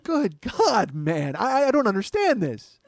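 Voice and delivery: high-pitched comic outburst; mock exasperation. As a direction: exclaim in baffled, hammed-up outrage.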